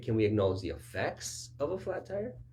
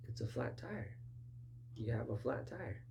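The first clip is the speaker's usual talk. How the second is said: gentle reassurance; 3 semitones higher, 8 dB quieter than usual. Retold or imitated. Gentle reassurance to defensive listener.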